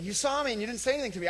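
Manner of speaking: high, fast whiny complaint; mock-offended, high and rushed; mock offended friend. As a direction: play the hurt friend, quick and high. Acted complaint from offended person.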